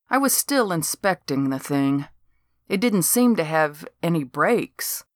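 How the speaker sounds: plain reading voice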